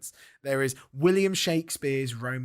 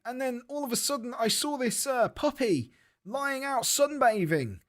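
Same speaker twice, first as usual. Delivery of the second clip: mock joke-telling; 8 semitones higher than usual. Tells a made-up bad joke.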